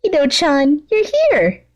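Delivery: put-on high, giddy girl's squeal; excited high voice for the villain girl; Toga's voice. Toga greets Mimicus.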